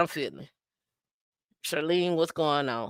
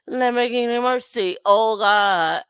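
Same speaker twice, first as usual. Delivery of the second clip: raised, loud Jamaican dancehall call; 4 semitones higher, 5 dB louder than usual. Acted. Host reacting to a viewer's name, Jamaican dancehall hype. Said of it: "go into a Jamaican dance".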